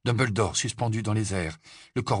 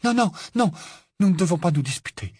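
high, loud, urgent intervention; flustered and high-pitched; exclaimed plea; 10 semitones higher, 5 dB louder, faster than usual. A narrator's reading. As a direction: break up the quarrel, pleading. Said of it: "s'exclama Hermione en se jetant entre eux".

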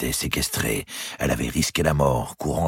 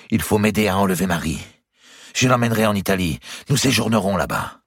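lightly voiced pleading nephew, higher; eager young lover; 7 semitones higher, 4 dB louder than usual. Nephew proposing an elopement to his uncle, mildly impassioned. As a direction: press the plan with some urgency.

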